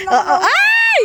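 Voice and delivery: high sudden squeal; excited shriek. Real, not acted. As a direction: squeal out in delight.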